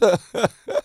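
quick chuckle; short laugh. Real, not acted. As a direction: laugh it off briefly. Laughing at his own point.

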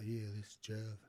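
a hint of a silky late-night host; impersonating Jeff. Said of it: "this is a terrible impersonation of Jeff".

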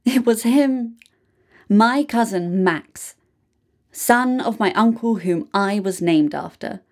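ordinary delivery

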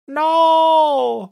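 loud, high, drawn-out "no"; villain's panicked shout; horrified cry. Acted. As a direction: cry out in horror.

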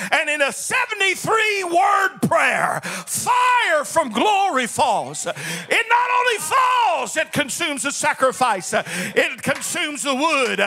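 full-force preaching. Acted. Preacher hyping the Super Bowl of gods to his congregation, full-force preaching. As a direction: preach it out to the congregation like a showdown announcement. Climax of the fire story.

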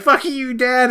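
high-pitched mock curse, not shouted; mock defiance. Imitating a son cursing his dad, high mock defiance.

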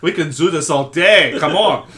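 mock war-movie German interrogator; movie German accent. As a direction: ham up a film Nazi interrogator. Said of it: "That, that pidgin German that comes through".

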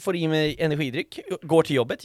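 ordinary talk

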